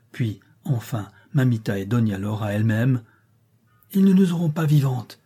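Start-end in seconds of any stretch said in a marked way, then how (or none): 3.9-5.2 high, loud defiant shout; defiant cry. A narrator's reading. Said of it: "crie Juana".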